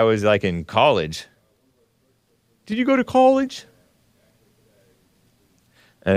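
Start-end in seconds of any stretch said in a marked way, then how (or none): 2.7-3.6 mock questioner. Host voices an imagined question to himself.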